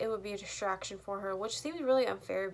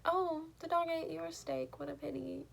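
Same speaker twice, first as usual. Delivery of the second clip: mock-sympathetic mom; 8 semitones higher than usual. Mom to dad about the eaten steak, mock pity. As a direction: imitate a mom pretending to be sorry. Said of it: "the mom goes to the dad".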